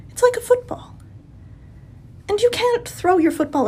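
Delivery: high breathy mock-reverent whisper. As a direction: whisper it like a sacred secret. Mocking the purity lecture.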